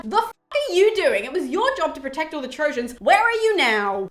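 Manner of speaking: acted-out outraged reproach; mock angry yell. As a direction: yell up at a god who let you down. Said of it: "he yells up to Apollo".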